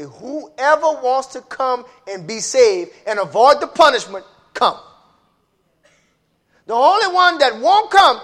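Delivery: high, loud fiery sermon; preaching at full force. Acted. Preacher calling sinners to judgment, loud and high. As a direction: build the preaching to a roar.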